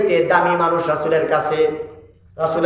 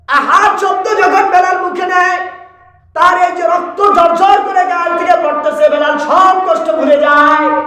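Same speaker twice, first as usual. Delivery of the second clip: impassioned preaching; 10 semitones higher, 3 dB louder than usual. Real, not acted. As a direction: stretch the phrases in a high preaching cry. Preaches at full force. (উঁচু সুরে টেনে টেনে ওয়াজ)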